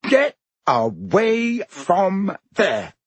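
very slow, high-pitched order; scolding, forceful. A narrator's reading. Percy orders Ron away like their mother, scolding. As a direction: swell up and space out every word. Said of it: "reminded Harry forcefully of Missus Weasley".

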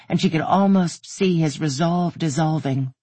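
plain reading voice

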